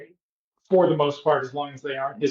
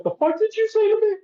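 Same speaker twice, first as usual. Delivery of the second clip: very high, quick squeal of disbelief; mock incredulous outrage; faster than usual. Woman challenges a man's second crude innuendo, fast high mock outrage. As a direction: rush it out in a high, put-on shocked voice. Acting out her second shocked reply.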